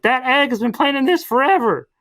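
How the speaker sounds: high-pitched mock exclamation; old man's outburst. Old prisoner revealing Humpty's long scheme, high mock indignation. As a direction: raise the pitch in character, emphatic.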